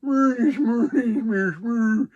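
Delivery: high, loud mock Swedish Chef babble; hammed-up Swedish Chef nonsense; Swedish Chef gibberish. Swedish Chef babbling through the chorus, mock gibberish. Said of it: "he just starts going".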